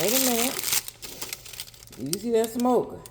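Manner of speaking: ordinary talk, nothing marked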